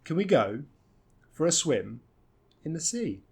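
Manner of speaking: pauses after "go" and "swim"; phrase by phrase, stressing GO, SWIM, SEA; three chunks. Shown as a demonstration. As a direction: break it up: "Can we go", "for a swim", "in the sea". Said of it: "three nice chunks here".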